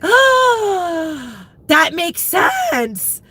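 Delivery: high, slow dawning surprise; as if it finally clicks, drawn out; slow amazed oh that makes sense; realization. Retold or imitated. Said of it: "I was like".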